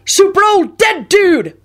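high, drawn-out cry; triumphant holler. A narrator's reading. Wizard casting a summoning spell, triumphant holler. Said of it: "Robbie hollered triumphantly".

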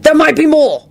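high, loud cry of alarm; urgent shout. A narrator's reading. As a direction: shout a warning at high pitch. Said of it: "Lupin shouted".

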